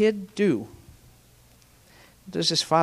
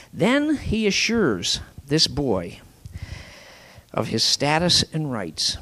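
ordinary talk, nothing marked

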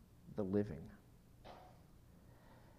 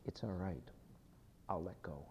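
soft, half-whispered prayer; quiet prayer of surrender; 5 semitones lower, 11 dB quieter than usual. Pretend. Congregation answering God in prayer, softly whispered. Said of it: "say right back to him".

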